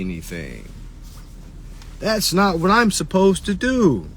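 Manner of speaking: high, indignant mock caller; mocking sovereign caller. Mocking a clueless caller who threatens to sue. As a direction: lampoon a clueless caller insisting he owes nothing. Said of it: "say things like".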